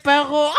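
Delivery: "perro" with a guttural r, mocking; mock French-r perro. Friend mocking the accent impression, guttural "perro". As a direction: mimic "perro" with the r in the throat. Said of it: "fucking like perro".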